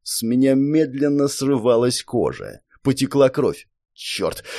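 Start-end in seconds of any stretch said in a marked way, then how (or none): 3.9-4.4 low, pained curse under breath; strained muttered curse. Acted. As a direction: hiss it through clenched jaw. Hero strains under crushing spell.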